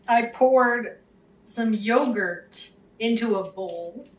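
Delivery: ordinary talk, nothing marked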